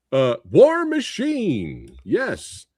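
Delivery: usual speaking voice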